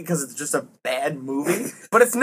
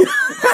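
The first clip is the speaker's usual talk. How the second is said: high squealing laugh. Real, not acted.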